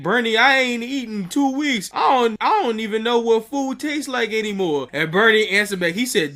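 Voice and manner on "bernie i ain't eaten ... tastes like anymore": higher-pitched imitation of a hungry man begging; mock pleading. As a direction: plead like someone starving, pitched up. Voices pleading homeless man.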